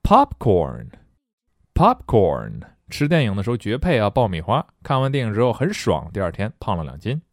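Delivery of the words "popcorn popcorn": Popcorn, popcorn stretched very slowly; drawn-out demo. Shown as a demonstration. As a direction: draw each popcorn out like a chant. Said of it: "vocabulary demo".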